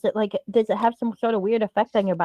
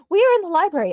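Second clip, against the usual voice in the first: high-pitched mock urgent message; mock excited exclamation; 6 semitones higher, 4 dB louder than usual. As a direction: play an over-eager text being sent. Acting out an exclamation-filled text message.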